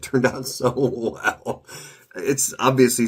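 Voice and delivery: slow, laughing delight. Real, not acted. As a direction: chuckle through the words. Laughs while praising result.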